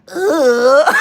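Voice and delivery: loud, high disgusted groan; loud disgusted ugh. Retold or imitated. Rejecting his proposals with a loud disgusted ugh.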